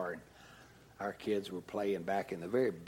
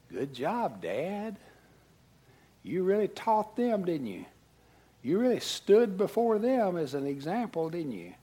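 higher, louder mock praise; sarcastic Holy Spirit; 7 semitones higher, 4 dB louder than usual. Holy Spirit sarcastically scolding the dad. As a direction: needle him with ironic praise. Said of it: "the Holy Spirit was saying to me".

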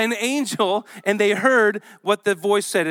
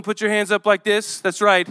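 ordinary delivery

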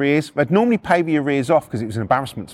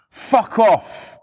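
loud, higher "fuck off"; retold angry shout; 6 semitones higher, 3 dB louder than usual. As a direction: yell abuse out of a window. Neighbour swears out of window.

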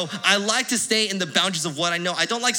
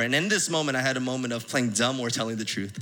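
ordinary talk, nothing marked

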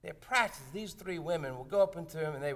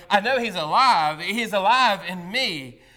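yelled; 9 dB louder than usual. Retold or imitated. Unscripted worshipper yelling his testimony from the pews. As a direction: shout the testimony out of turn. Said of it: "and yelled".

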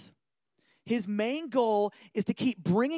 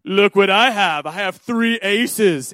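slow, smug boasting card player; cocky poker player; 2 semitones lower, 12 dB louder, slower than usual. Retold or imitated. Arrogant poker player showing off his hand, slow and smug. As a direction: lay the aces down like you've already won. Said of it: "really cocky and arrogant".

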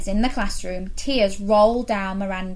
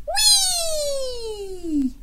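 high, delighted slide-down whee; gleeful whee. Acted. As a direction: squeal it like you're whooshing down a slide. Child sliding down snake.

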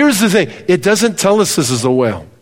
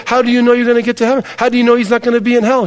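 fast, raised, scoffing challenge; scoffing boss; 7 semitones higher, much faster than usual. Retold or imitated. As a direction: fire the questions at her to mock her. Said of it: "He said".

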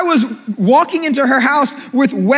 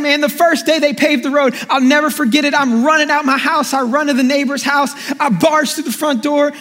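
ordinary talk, nothing marked

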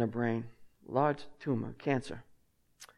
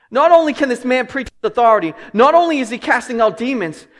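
loud, rising sermon delivery; preaching at full force; 7 semitones higher, 9 dB louder than usual. Real, not acted. Pastor preaching grace to the church, loud and driving. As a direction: preach it hard to the congregation.

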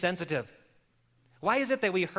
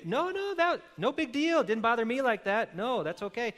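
much higher, quick dismissive no no; surprised brush-off; 7 semitones higher than usual. Retold or imitated. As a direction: wave off the apology in surprise. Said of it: "They may".

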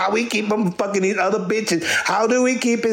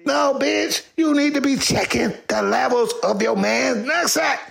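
ordinary talk, nothing marked

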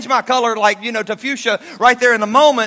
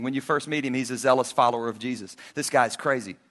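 ordinary talk

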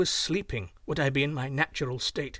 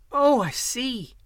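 put-on much higher, slow girlish "oh"; girl's realization; 8 semitones higher, 5 dB louder, slower than usual. A narrator's reading. Hugh Lin grasps the name's meaning, high slow girlish. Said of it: "said Hugh Lin and Chang together".

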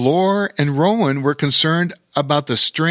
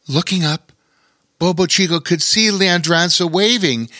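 plain reading voice

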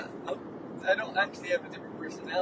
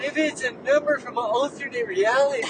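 high, raised playful protest; mock urgent insistence; 5 semitones higher, 6 dB louder than usual. Partner defending the number, loud mock insistence. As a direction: keep pressing the theory loudly.

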